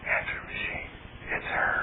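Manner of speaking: slow hushed inner voice; whisper. Retold or imitated. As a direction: whisper it slowly like a tempting thought. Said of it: "the little voice goes".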